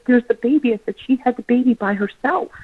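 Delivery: high, choked-up retelling; tearful realization. Retold or imitated. Telling husband about the baby, choked up. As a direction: let it catch in the throat.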